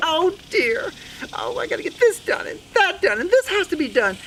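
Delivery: high-pitched flustered mouse lady; squeaky, overwhelmed little mouse; fretting Sue Mouse. Acted. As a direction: fret aloud over all the chores.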